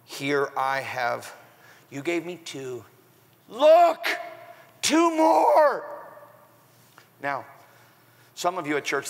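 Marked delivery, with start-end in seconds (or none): 0.1-2.8 quiet slow lead-in. Acted. Acted servant before the reveal. 3.5-5.8 excited shout. Acted. Acted servant reveals his gain.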